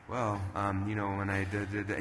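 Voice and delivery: low, soft, halting answer; imitated dull, hesitant answer; no-energy mumbling. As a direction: mumble it as a lifeless interviewee. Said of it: "you have no energy".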